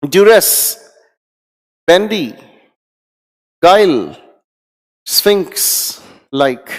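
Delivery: teacher leading a loud word drill; loud recital. Shown as a demonstration. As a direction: drill the word list aloud for the class. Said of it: "मेरे साथ ऊंची आवाज में बोलने की कोशिश करें".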